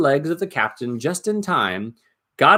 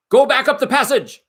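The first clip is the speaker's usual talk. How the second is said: much higher, loud shouted order; goblin shout; 14 semitones higher, 6 dB louder than usual. Acted. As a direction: yell it up the tunnel. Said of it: "some shouted".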